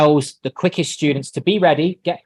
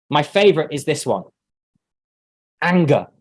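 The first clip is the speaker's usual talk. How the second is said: normal talking voice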